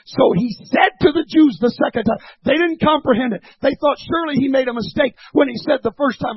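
loud, driving pulpit delivery; forceful preaching. Real, not acted. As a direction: preach it out, building toward the punchline.

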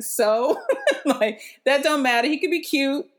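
loud, high imitation of a blunt friend; dismissive retort. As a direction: brush the objection off emphatically. Friend dismisses her in retold argument.

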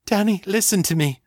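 trying to get through to him; urgent and shaky. A narrator's reading. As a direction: Ava pleading with Danny. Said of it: "urgent and shaky".

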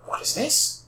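hushed, breathless wonder; awed whisper. Acted. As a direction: whisper it in awe. Villain awed by the scarab.

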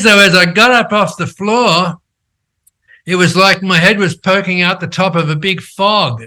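ordinary talk, nothing marked

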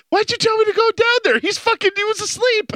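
high-pitched mock protest; indignant complaint. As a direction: re-enact an exasperated accusation. Re-enacts complaint to friend's wife.

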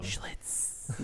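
whispered. Shown as a demonstration. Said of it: "you go".

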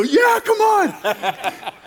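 high, slow hammed-up crowd cheer; mock crowd cheer. Pastor voicing the crowd begging for the joke, hammed-up cheer. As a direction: egg yourself on like an eager crowd. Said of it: "can I tell you the joke".

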